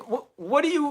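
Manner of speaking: ordinary talk, nothing marked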